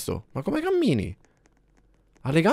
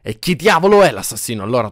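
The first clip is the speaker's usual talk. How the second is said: ordinary talk, nothing marked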